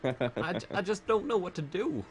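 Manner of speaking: halting impression, pitch jumping up on odd words; Christopher Walken. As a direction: break phrases with pauses in strange places. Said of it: "My, my Christopher Walken".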